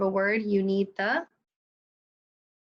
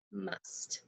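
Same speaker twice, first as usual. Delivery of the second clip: soft blended word; 2 semitones lower, 14 dB quieter than usual. Shown as a demonstration. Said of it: "Spell it".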